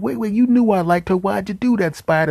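high, loud mock outrage; indignant protest. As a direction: squawk a hurt protest at a friend. Acted protest at betrayal over woman.

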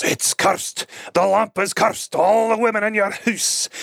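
hammed-up ominous warning from the old man; mock spooky old man. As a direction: croak the curse like a cartoon prophet. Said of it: "He goes".

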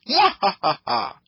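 slow hammed-up cackle; mock gloating laugh; triumphant laugh. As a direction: stretch the laugh out in glee. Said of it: "Kaioshin starts laughing".

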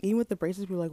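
ordinary talk

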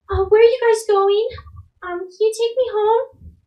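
put-on high, small lost child asking for help; lightly pleading child voice; little girl voice.

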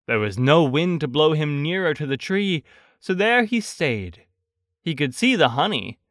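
usual speaking voice

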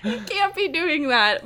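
high, giggling scold; teasing friend laughing at Lizzie. Retold or imitated. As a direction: scold her through laughter. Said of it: "And I'm like".

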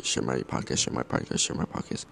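whispered hidden message; subliminal whisper. Shown as a demonstration. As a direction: breathe it in their ear like a hypnotist. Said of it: "plant subliminal message".

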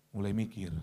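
dropped, low pitch after the challenge; mock hesitation. Preacher teases congregation's second thoughts, low mock hesitation.

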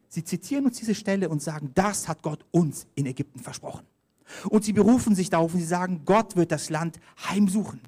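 normal talking voice

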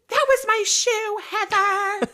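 mock yell, very high and loud; shrill-pitched imitated yelling; Whitney. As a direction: screech it as a hammed-up impression. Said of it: "Whitney yells at Heather".

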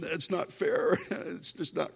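whiny flesh voice. Retold or imitated. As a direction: whine like a sulking kid. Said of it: "And sometimes my flesh".

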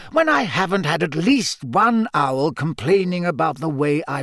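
put-on high-pitched, emphatic old headmaster; firm headmaster. Headmaster reassuring his gamekeeper, firm and insistent. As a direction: press the point as headmaster. Narrator voices Dumbledore.

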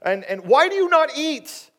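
loud, high-pitched baffled questioning; exasperated husband. Acted. Husband not getting his wife's pain, exasperated. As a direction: press her with high, insistent questions. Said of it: "And he's like".

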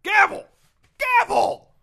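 shouted high and loud; mock gavel call. Host plays a judge calling the court to order, shouting. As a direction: bang the gavel with your voice.

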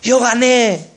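high, mock-triumphant boast; boasting sports fan. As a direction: imitate a fan claiming the win. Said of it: "¿Por qué él dice".

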